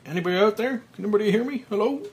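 high, loud panicked calls; panicked calling. Acted. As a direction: call out in panic. Panic breaths, buried in coffin.

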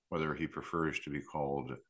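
ordinary delivery

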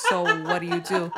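high-pitched, laughing interrogator impression; mock border officer. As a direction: press the question as a teasing mock officer. Acted border officer questioning.

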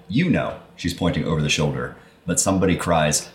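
ordinary talk, nothing marked

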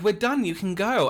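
high, loud retold send-off; loud, high-pitched, at the end of patience; exasperated dismissal. Retells dismissing the girl, exasperated.

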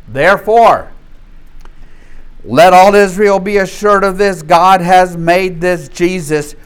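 preacher reading Acts to the congregation, proclaiming loudly; scripture reading. Acted. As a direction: proclaim the verse to the church. Reads the verse aloud.

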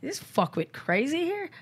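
mild, high-pitched indignation; retold growing anger. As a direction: retell angry disbelief without raising the volume. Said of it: "I'm like, I'm getting angry".

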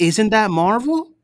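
cousin's question. Retold or imitated. Cousin at Christmas dinner asking Dwayne about Deadpool, high naive voice. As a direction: play the clueless cousin asking innocently.

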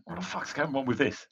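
sudden exasperated outburst; what is going on, confused; baffled outburst. Acted. Cran reacts to the sword.

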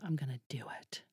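a hushed private decision; whispered resolve. Retold or imitated. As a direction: whisper it softly to yourself. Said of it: "Sometimes I'm just like".

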